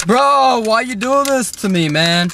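drawn-out whiny protest; exasperated whine. Pretend. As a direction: plead in mock despair. Struggles with the packaging.

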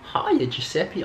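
high, drawn-out New Zealand greeting; strong New Zealand accent. Retold or imitated. Boyfriend imitating her gran on the phone, strong New Zealand accent. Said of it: "she has the strongest New Zealand accent".

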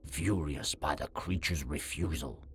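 normal talking voice

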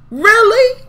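sharp high disbelieving rise; are you kidding me?; incredulous really. Real, not acted. Incredulous reaction to Sunday Carter.

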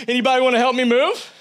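high, loud, cartoonish call to the room; mock plea for help. Man fishing for moving help in a crowd, high loud mock call. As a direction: ham up a guy begging for helpers. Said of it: "He's like".

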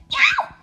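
shrill, very high, loud cat screech; cat screeching in fright at the lion; frightened yowl. Acted. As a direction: yowl in terror. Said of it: "Puss got a terrible fright".

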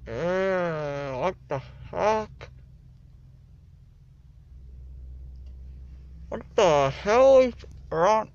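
rising loud what the hell; startled, loud and disgusted; shocked disgust. Real, not acted. Tasting something gross.

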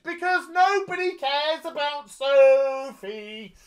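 high, slow, sing-song "nobody cares"; sneering at the show; sarcastic drawl. Real, not acted. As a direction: stretch it out mockingly. Repeating a sarcastic refrain.

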